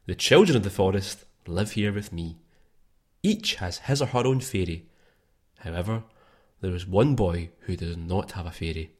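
bedtime story read. Acted. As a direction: tell it gently like a forest tale. Reads Deku tree intro.

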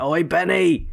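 mock British lad calling out; a cheeky London "Oi" in a put-on British accent; joking voice of a British lad, mock British accent. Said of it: "like a British lad".

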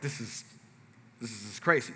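low, soft stunned aside; acted hushed disbelief; awkward murmur. Awkward moment, murmured to himself.

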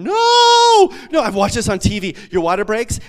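high, loud frantic scream; screaming in panic. Retold or imitated. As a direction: shout it like the baby's coming now. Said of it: "and I'm screaming".

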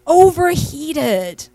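put-on a boy searching for the right word, drawn out; child's voice. Mother quoting her son explaining, excited child voice. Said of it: "He goes".

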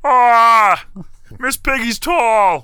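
high, loud froggy Kermit voice with a final cry; Kermit impression. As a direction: squawk it out like Kermit. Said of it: "let's see if I can do Kermit".

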